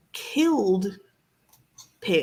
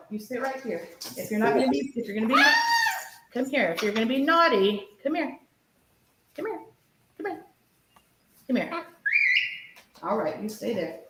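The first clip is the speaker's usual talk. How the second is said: coaxing pet; 4 semitones higher, 3 dB quieter, faster than usual. Real, not acted.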